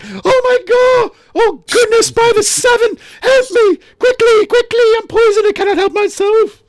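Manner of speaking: very high, loud squealing plea; excited panicked character. Acted. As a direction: squeal it way up high, begging for help. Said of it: "that's what he sounds like when he's excited".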